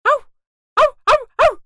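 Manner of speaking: loud, high playful woof woof; dog barking. Acted. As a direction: bark like a happy puppy. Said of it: "ومن بعيد ينبح".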